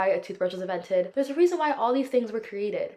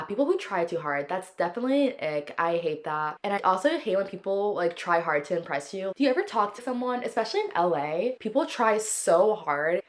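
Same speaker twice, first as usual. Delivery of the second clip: ordinary talk, nothing marked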